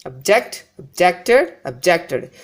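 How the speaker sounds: weak ob, strong JECT in object and objected; stress on second syllable. Shown as a demonstration. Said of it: "the first syllable is almost given less importance".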